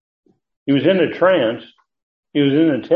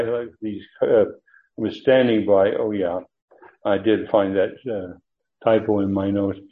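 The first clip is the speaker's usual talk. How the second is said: ordinary talk, nothing marked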